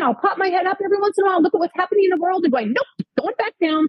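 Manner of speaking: high, quick comic peek and retreat. Acted. Writer peeking at world news from rabbit hole, comic retreat. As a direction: rush it up high, then duck away.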